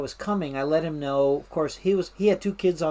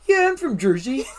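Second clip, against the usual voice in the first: high, louder fake Jersey accent; put-on Jersey accent; 9 semitones higher, 5 dB louder than usual. Newcomer passing as a local, in a put-on Jersey accent. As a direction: bluff it in a borrowed New York-Jersey accent. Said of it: "I quit talking with my accent".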